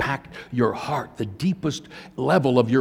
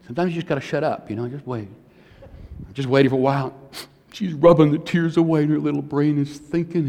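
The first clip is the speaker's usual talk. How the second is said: ordinary talk, nothing marked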